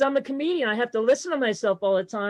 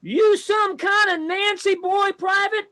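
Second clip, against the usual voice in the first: barking drill sergeant, pitched higher; 7 semitones higher than usual. Retold or imitated. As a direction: taunt the recruit like a drill sergeant. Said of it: "when I was in the Army".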